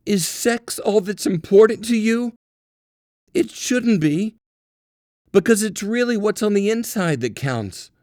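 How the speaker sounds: high, loud, sing-song mock lecture; mocking preachy scold. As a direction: parrot the scolding in a shrill-sounding put-on voice. Mocking the conventions that shame him.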